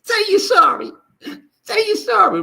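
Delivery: loud, very high mocking voice; mock bullying demand. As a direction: demand an apology in a loud squeal. Acting out demand for an apology.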